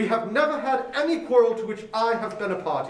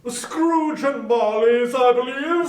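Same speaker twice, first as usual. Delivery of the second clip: slow, courteous, rounded voice; lightly voiced charity gentleman; polite portly gentleman; 2 semitones higher, 5 dB louder, slower than usual. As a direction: greet him with formal politeness. Portly gentleman collecting for poor.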